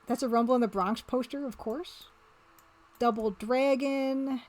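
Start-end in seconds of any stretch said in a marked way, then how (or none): 3.0-4.4 slow stretched "Double Dragon" with a drawl; odd drawn-out Double Dragon. Shown as a demonstration. Streamer reading a poster title to chat, slow regional drawl. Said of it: "I don't know why I said it like that".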